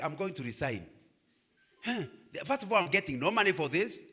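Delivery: ordinary talk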